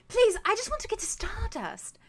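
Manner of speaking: put-on high, airy, pleading young woman; light airy bard girl. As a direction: voice the bright tiefling begging to get through. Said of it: "very airy sort of, like, voice".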